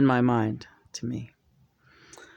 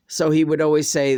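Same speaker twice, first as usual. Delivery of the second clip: usual speaking voice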